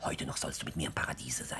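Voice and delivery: low, secretive whispered promise. Acted. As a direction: breathe the promise close to her ear.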